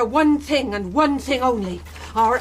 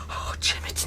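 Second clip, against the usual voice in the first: frightened whisper; 9 dB quieter than usual. Acted. Mother frightened of corpse.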